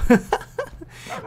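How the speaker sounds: airy high-pitched giggle; breathy high laugh. Real, not acted. Streamer amused by a surprise puppy, airy high giggle. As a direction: laugh in delighted disbelief.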